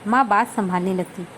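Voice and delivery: plain reading voice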